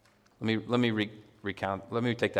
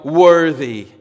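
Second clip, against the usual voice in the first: a big, louder shout; yelled worthy; 4 semitones higher, 9 dB louder than usual. Shown as a demonstration. Preacher to congregation, full-voiced shout of praise. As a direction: cry it out full voice. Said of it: "You wanna yell".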